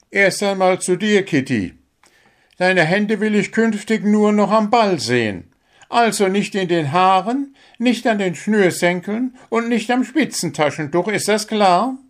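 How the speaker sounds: a little bird coach giving his captain a sharp dressing-down; scolding bird coach. Acted. Coach bird scolding the sent-off captain.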